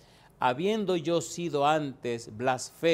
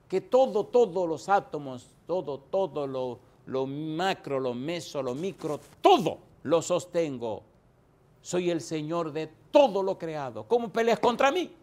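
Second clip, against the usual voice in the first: booming rebuke; 3 semitones higher, 4 dB louder than usual. Acted. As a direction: thunder it out as the sovereign Lord. Voiced Lord's rising rebuke.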